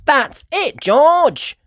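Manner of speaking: high, loud eureka; excited cry. A narrator's reading. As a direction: shout out the clever idea. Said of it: "cried Peppa".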